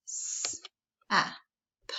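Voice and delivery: soft unvoiced S-A-P, sound by sound; whispered letter sounds. Shown as a demonstration. Teacher sounding out S-A-P softly. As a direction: tap out S-A-P in a whisper. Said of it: "you can pound it and tap it".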